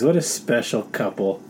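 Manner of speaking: ordinary talk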